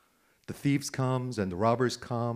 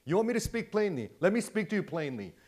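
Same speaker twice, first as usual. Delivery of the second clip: raised, emphatic voice as Jesus; higher-pitched, insistent quoting; plain-spoken retort; 7 semitones higher than usual. Retold or imitated. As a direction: answer the accusers bluntly. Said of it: "And Jesus plainly says".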